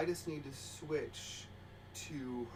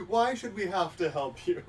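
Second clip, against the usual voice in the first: laughing rude operator line; 4 semitones higher, 4 dB louder than usual. Pretend. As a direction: laugh through a sarcastic answer. Mock rude hotline operator.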